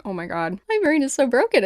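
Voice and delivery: ordinary delivery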